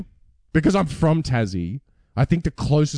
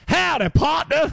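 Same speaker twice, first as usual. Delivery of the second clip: much higher, slow hammed-up Texan drawl, shouted; Texan accent; slower than usual. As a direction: holler like a cartoon cowboy, Texan accent. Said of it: "They're all Foghorn Leghorn".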